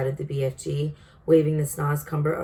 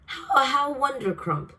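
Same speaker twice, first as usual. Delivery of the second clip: high, slow, delighted gush of "wondercrump"; a giant overjoyed by a compliment; beaming cry; 9 semitones higher, slower than usual. A narrator's reading. Giant thanks the girl for praise, beaming high cry. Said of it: "cried the B F G, still beaming".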